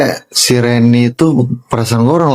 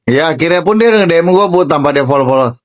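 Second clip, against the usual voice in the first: ordinary talk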